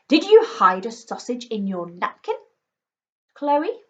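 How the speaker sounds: stern demand with a rising question. A narrator's reading. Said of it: "demanded Mother".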